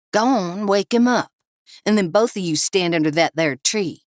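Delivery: a hint of outlaw bossiness; menacing gunman. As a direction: push the captives along. Gunman Cliff orders captives.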